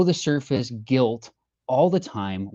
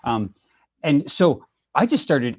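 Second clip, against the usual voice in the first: ordinary talk, nothing marked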